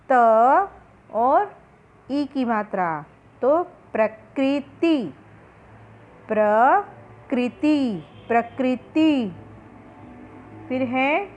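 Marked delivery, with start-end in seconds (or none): 3.4-9.3 प्रकृति stretched out very slowly, pra-kri-ti; slow syllable reading. Shown as a demonstration. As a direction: drag out प्रकृति for the children. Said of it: "री की मात्रा".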